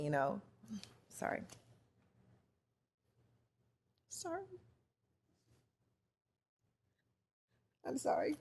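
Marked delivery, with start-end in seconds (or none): 1.1-8.4 tearful, barely holding it together; real tears, whispered sorries breaking through; choked up, apologizing. Real, not acted. Breaking down to the listeners while retelling the hotel story. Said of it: "this story gets me every time".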